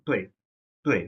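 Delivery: "তোয়ে" said short with a fall; quick fourth tone. Shown as a demonstration. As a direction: cut "তোয়ে" short as the pitch drops. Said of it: "আমরা দ্রুত বলবো". ("তোয়ে" দ্রুত, নামিয়ে)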